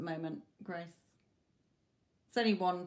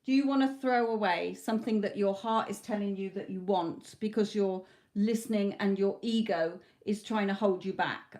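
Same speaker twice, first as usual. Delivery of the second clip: ordinary talk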